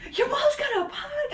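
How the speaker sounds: hammed-up stunned teenage boy; imitating a boy gasping in disbelief; amazed kid voice. Repeating the boy's line.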